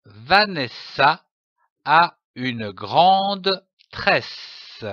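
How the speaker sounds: slow, with a pause after each chunk of the sentence; syllable by syllable. Shown as a demonstration. Teacher to young readers, slow and broken into syllables. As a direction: read it slowly, one piece at a time, like sounding out for a child. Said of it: "phrase découpée en syllabes".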